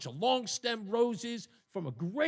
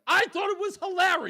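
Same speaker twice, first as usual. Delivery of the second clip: laughing; 12 semitones higher, 9 dB louder than usual. Real, not acted. Laughing defence of his joke.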